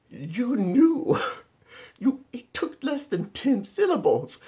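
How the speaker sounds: high, loud, disbelieving cry; exasperated retort. Retold or imitated. To the advisor afterwards, an exasperated high loud cry. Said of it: "And I was like".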